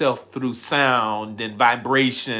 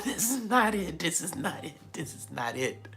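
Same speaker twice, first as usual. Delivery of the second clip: laughing, then trailing down softly; mock disapproval; 5 semitones higher, 9 dB quieter than usual. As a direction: let the imitated objection fade away. Elders' disapproval, laughing, dropping away.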